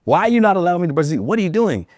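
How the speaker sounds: mock exasperation at a stubborn kid; a retold, lightly hammed-up frustrated question; frustrated dad. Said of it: "I got a little frustrated".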